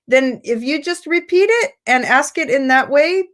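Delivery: usual speaking voice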